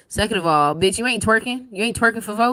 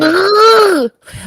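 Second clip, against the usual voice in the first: loud shocked gasp; 11 semitones higher, 11 dB louder than usual. Real, not acted.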